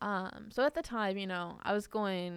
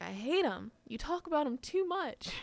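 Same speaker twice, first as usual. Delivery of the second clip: bratty little sister; 8 semitones higher than usual. Retold or imitated. Imitates little sister talking back.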